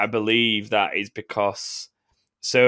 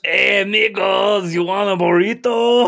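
high, loud, slow cartoon Mexican accent; on request, a slow, loud Mexican-accent burrito offer; 10 semitones higher, 6 dB louder than usual. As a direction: stretch out the Mexican accent for laughs. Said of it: "Please do it again".

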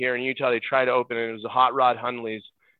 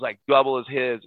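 ordinary delivery

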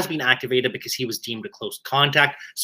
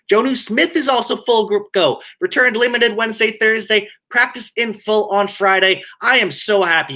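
smiling, higher and upbeat; happy; 7 semitones higher than usual. Real, not acted. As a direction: say it with a big grin. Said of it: "You can hear the smile in my voice".

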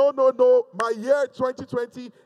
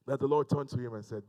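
ordinary talk

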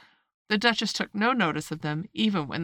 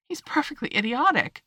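higher-pitched exasperation; desperate; 6 semitones higher than usual. A narrator's reading. Alice giving up on the footman, desperate and exasperated. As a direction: give up on him, voice lifting. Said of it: "said Alice desperately".

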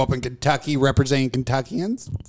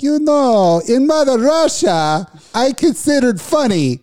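mocking Yakov Smirnoff with a fake laugh; mock Russian accent; 9 semitones higher than usual. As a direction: parody a Russian comic's canned laugh. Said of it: "Yakov Smirnoff is like".

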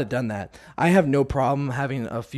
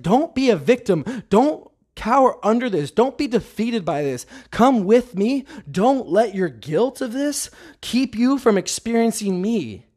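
higher-pitched, retold rallying plea; urgent encouragement; 6 semitones higher than usual. Holy Spirit rallying the speaker out of guilt, urgently encouraging. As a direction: urge them on with raised pitch. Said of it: "he's sitting here, and he's like".

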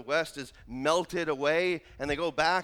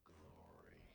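breathy hushed whisper on one word; 30 dB quieter than usual. Real, not acted. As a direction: drop to a whisper for the reveal. Dramatic hush on Oz's glory.

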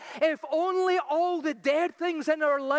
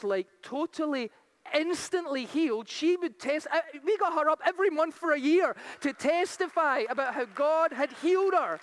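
ordinary talk, nothing marked

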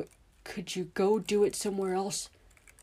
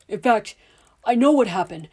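loud, higher, heated insistence; angry shouting; 5 semitones higher, 11 dB louder than usual. Acted. Father shouting back while interrupting the official. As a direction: hammer the point home, shouting. The men pushing, Dudley cutting in.

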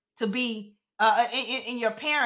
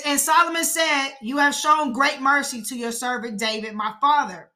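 raised, emphatic Bible reading; scripture reading; 4 semitones higher, 4 dB louder than usual. Acted. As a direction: read the verse out with weight. Reads scripture aloud.

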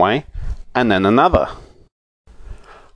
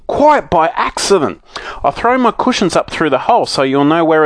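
great excitement; 9 semitones higher than usual. A narrator's reading. Moonface calling up through the hole, excited. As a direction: bubble over with news. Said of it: "in the greatest excitement".

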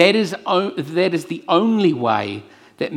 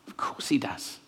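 unvoiced, soft reassurance; whispered assurance; 10 dB quieter than usual. Real, not acted. Preacher assuring the congregation of God's love, whispered. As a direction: let it land as a hushed promise. Answering his own question.

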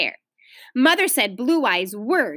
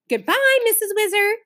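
high, rising courteous farewell; polite goodbye; 10 semitones higher than usual. A narrator's reading. Big sister parts from the neighbour, politely. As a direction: say it politely, pitched well up and rising. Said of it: "said Beezus politely".

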